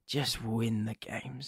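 low, soft, slow plea; weary pleading. Real, not acted. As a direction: plead wearily under your breath. Pleading with his football team.

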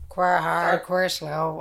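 low, lightly done Wexford sayings; Wexford accent. Shown as a demonstration. Demonstrating local sayings to the co-host, light Wexford accent. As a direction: try a touch of Wexford dialect. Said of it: "if you're in Wexford, you just say".

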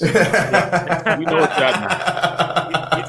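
laughing through the words, slow. Real, not acted. Host laughing as he repeats the line to his co-host. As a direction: chuckle it out knowingly.

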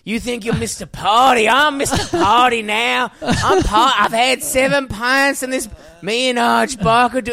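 put-on loud, high drunken boasting; drunk mate. Drunk mate boasting down the phone, loud and high. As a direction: brag like a tipsy self-styled party king. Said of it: "doing an impersonation".

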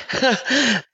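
high laugh through tears; teary laugh. Real, not acted. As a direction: laugh away the crying.

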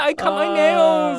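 high, slow, whiny protest; mock upset. Defending herself to the friend, high slow whine. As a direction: wail it like a wounded diva. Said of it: "I'm so upset".

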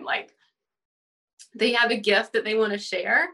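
ordinary talk, nothing marked

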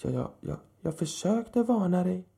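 put-on an embarrassed old man tripping over his words; stammering old man. A narrator's reading. Old man stammers an apology to Michael, flustered. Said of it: "stammade sina nästa ord".

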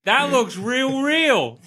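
gawking commoner. Acted. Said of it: "nudge the nearest commoner and be like".

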